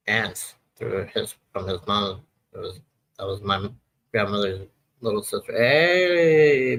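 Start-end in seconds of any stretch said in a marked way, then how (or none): 5.5-6.8 higher, louder bright hello to a viewer; hey, a viewer just joined, greet them with delight; excited greeting. Real, not acted. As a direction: call out a happy hey to a friend arriving.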